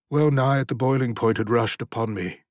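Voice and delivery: ordinary delivery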